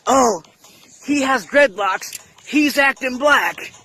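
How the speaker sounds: high-pitched mocking imitation of a complainer; mock outrage. Mocking people who complain about dreadlocks, in high-pitched mock outrage. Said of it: "people gotta stop culture appropriating and talking about".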